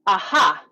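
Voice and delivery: sticky ah, then a loud breathy ha; two-part vocal warm-up aha; breath exercise aha. Shown as a demonstration. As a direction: pop the ah and send out the ha. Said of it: "So the first one is".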